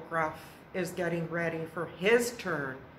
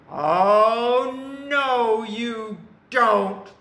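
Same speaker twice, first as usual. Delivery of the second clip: very loud, raised troll refusal; defiant troll; 5 semitones higher, 9 dB louder, much slower than usual. A narrator's reading. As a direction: block the goat with a drawn-out bellow.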